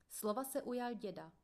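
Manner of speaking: ordinary delivery, nothing marked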